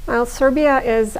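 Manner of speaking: ordinary talk, nothing marked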